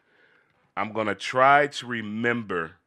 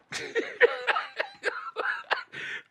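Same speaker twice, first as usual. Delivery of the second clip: high laugh; 4 dB quieter than usual. Real, not acted. Laughs at own answer.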